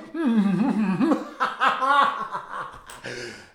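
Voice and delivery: mocking laughter. Crowd laughs at Jesus.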